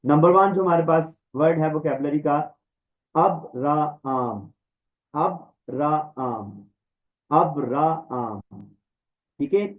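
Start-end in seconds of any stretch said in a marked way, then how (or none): 3.1-8.6 very slow अबराआम, every letter said apart; अब-रा-आम, long aa, no h; drilled pronunciation. Shown as a demonstration. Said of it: "इनको pronounce करना सीखना है".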